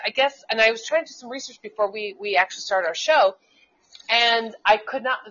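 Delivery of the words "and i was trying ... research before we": normal talking voice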